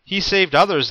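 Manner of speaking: loud, high scoff. A narrator's reading. Priests mock Jesus, loud high scoffing. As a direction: sneer it out. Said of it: "they scoffed".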